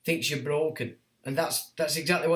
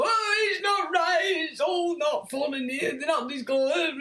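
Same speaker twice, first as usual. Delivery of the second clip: high, shouted, drawn-out whine; mocking complainer, pitched way up; mock whining; 9 semitones higher, slower than usual.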